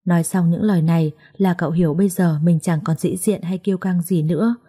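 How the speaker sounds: usual speaking voice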